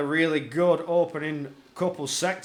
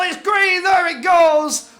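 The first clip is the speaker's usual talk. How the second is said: excited shout; 10 semitones higher, 10 dB louder, slower than usual. Real, not acted. Race restarts.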